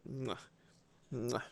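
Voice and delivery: ordinary talk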